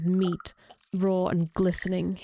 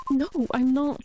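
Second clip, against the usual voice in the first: panicked no to the ghost; 6 semitones higher than usual. Acted. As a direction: insist you're not a cheater. Denies being a cheater.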